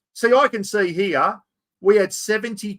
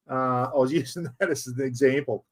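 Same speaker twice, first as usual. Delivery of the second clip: laughing; 6 semitones lower, 3 dB quieter than usual. Real, not acted.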